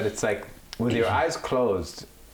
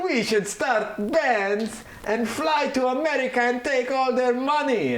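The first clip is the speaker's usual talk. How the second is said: very high, louder cartoon Italian accent; hammed-up Italian accent; 4 dB louder than usual. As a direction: keep the mock Italian accent going, scheming.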